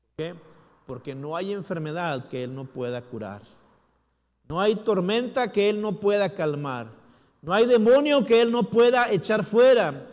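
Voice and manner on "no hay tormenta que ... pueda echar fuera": high, loud, building sermon climax; preaching at full force. Real, not acted. Preacher proclaiming God's power to the church, full-force preaching. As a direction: thunder each line louder than the last.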